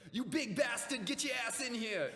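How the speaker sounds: loud, high-pitched New York bellow; hammed-up New York wrestler shout; heavy Brooklyn accent. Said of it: "in his heavy-ass New York, Brooklyn accent".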